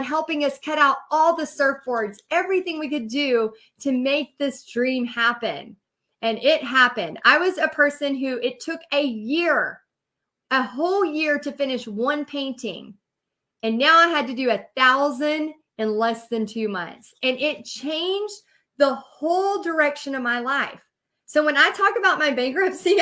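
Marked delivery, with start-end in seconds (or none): none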